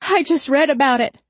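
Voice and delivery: high-pitched panicky denial; panicked protest. A narrator's reading. Handcuffed man hyperventilating, pleading innocence.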